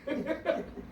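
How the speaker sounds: high breathy laugh mid-story. Real, not acted. Pastor laughs recalling the sleepless night, high laugh. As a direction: burst out laughing at the memory.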